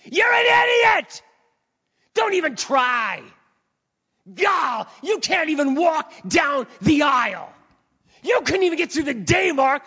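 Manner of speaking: hammed-up accuser yelling insults; devil reaming someone out. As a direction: berate them at the top of your voice. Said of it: "he just reams you out".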